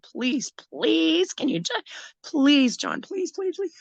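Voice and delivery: high, urgent begging, please after please; desperate pleading. Retold or imitated. Pleading with her armed partner to let her go to the bathroom, high desperate begging. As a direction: beg him like you can't hold it a second longer.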